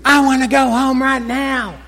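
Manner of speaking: put-on high whiny child yelling through a laugh; mock toddler tantrum. Preacher imitating the girl screaming on the floor. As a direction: play the shrieking little girl. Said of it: "she keeps screaming".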